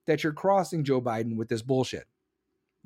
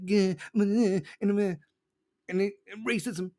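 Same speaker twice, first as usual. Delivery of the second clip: lightly voiced mumbling politician parody; mock a preacher-like politician, words half formed; mocking mimicry; 3 semitones higher than usual. Host mocking how the governor talks, mumbled mimicry. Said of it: "He talks just like him".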